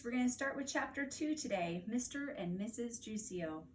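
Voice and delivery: bright, high teacher hello. Real, not acted. Teacher greets first graders before reading, bright and high. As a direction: greet the little class warmly. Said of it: "Hi, first graders".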